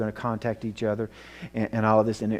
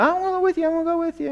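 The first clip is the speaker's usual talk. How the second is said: put-on high, pleading child voice, much higher and louder; little girl's plea; 4 dB louder, much faster than usual. Mother imitating her little daughter begging to go with the boys, high pleading child voice. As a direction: imitate a small girl begging to tag along. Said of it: "and she was going".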